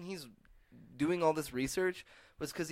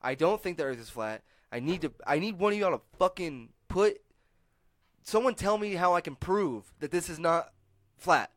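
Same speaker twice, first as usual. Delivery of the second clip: mock upset rant building higher and louder; imitating upset rant; 6 semitones higher, 8 dB louder than usual. Comedian begs viewers for proof, building mock outrage. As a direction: rant like a man losing his mind. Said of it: "he was getting really upset".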